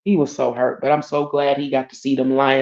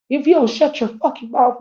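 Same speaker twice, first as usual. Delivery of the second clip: higher, a touch of an angry father; mock angry dad; 9 semitones higher than usual. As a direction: imitate dad snapping at daughter. Dad voiced yelling at her.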